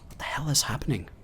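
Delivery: fast, hushed, scared whisper; frightened whisper. Acted. Scientist hiding from creatures, whispering fast in fear. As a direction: whisper it so the monsters can't hear.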